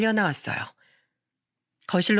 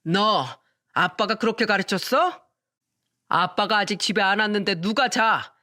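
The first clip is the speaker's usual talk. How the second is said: put-on drunken scolding. Drunk father scolding sleepy son, slurred and stern. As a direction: press the boy with slurred blame. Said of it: "다그치듯 말했습니다".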